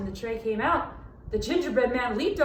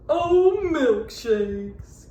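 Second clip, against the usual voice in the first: loud, dazed cow exclamation; dizzy cow cry; 5 dB louder, much slower than usual. A narrator's reading. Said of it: "cried the very dizzy cow".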